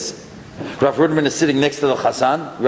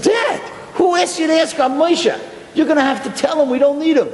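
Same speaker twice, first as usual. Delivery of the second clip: son pleading with his father; lightly voiced alarmed son; upset son; 12 semitones higher than usual.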